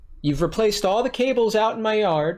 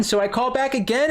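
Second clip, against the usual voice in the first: usual speaking voice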